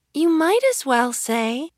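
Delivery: high little dormouse voice; sleep-talking Dormouse. A narrator's reading. Dormouse adding its bit in its sleep, high-pitched. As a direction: talk as a tiny creature half asleep. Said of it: "talking in its sleep".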